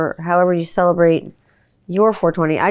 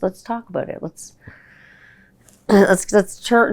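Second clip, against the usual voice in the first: usual speaking voice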